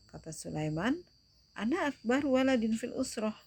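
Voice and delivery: teacher demonstrating dialogue intonation; reading a dialogue aloud. Shown as a demonstration. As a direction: read the Arabic dialogue like real conversation for the class. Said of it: "salah satu contoh cara membaca teks dialog".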